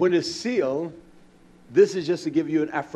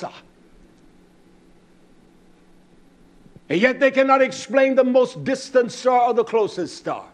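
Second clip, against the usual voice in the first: driving sermon, building higher and louder; fervent preaching; 7 semitones higher, 8 dB louder than usual. Real, not acted. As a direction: preach with mounting force and indignation. Preaches at full force.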